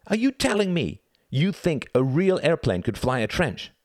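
high-pitched, indignant questioning; incredulous challenge. A narrator's reading. Reginald argues with his brother over rules, incredulous. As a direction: challenge him in high-voiced disbelief.

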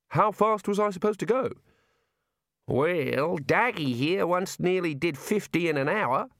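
rough outback shearer; gruff shearer voice. Acted. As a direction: play a blunt sheep shearer. Shearers' Australian voices in dialogue.

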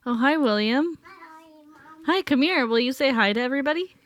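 high, sing-song parent voice. Real, not acted. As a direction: coax a little one to say hi. Parent greeting and coaxing small child.

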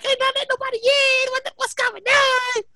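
high, drawn-out mock holler; alarmed nurse impression. Nurse finds nobody getting in, mock-alarmed high holler. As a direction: imitate a nurse calling out in alarm.

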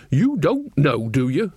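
ordinary delivery, nothing marked

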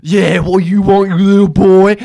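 loud, high, belligerent caricature; hammed-up heckling; imitating crackhead lady. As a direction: square up as an aggressive addled woman. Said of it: "cranes her neck at me and goes".

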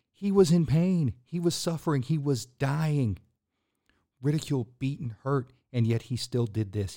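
fervent preaching climax; preaching at full force. Acted. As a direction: lift it up and hammer the suffering home.

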